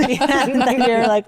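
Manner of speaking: normal talking voice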